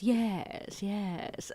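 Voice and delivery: lightly voiced evil genius, posh; a hint of a gloating villain. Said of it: "borderline my evil genius voice".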